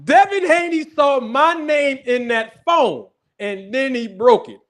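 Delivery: slow, high, loud shouted reveal; gleeful shouting. Real, not acted. Streamer gleefully shouting his big reveal to viewers, high and slow. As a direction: trumpet the punchline.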